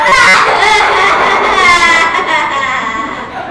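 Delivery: loud hammed-up stage sobbing; over-the-top fake bawling; dramatic mock crying. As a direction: wail hugely for the audience. Demonstrating crying in dramatic audition.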